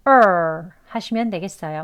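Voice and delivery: ordinary talk, nothing marked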